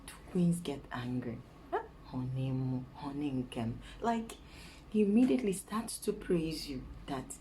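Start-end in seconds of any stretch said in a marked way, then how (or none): 0.1-3.7 imitating a low, coaxing husband; soothing flattery. Husband praising his angry queen, soothing flattery. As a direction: lay on gentle praise in a lowered voice. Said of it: "when I'm angry, he's like".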